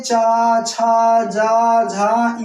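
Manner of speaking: चा, छा, जा, झा each said loud and separate; palatal sounds drill. Shown as a demonstration. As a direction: punch each syllable for the class. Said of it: "ताल से कौन कौन वर्ण निकलते हैं".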